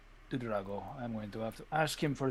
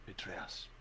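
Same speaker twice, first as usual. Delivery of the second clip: breathy conspiratorial whisper, much softer; 10 semitones lower, 19 dB quieter than usual. Acted. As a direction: lean in and hiss it as a secret. Plotting betrayal, hushed confession.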